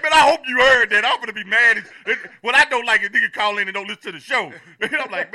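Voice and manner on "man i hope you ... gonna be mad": mock outburst.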